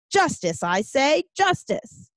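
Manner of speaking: high, declamatory protest cry; demanding justice. Acted. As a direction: proclaim it like a table-pounding protester. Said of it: "stop pounding on the table".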